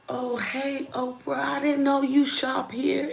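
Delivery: high-pitched, excited mock fan greeting; starstruck fan. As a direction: act out a fan gushing at a celebrity. Fan spots celebrity out shopping.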